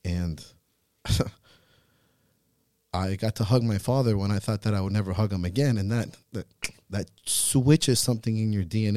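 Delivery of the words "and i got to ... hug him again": normal talking voice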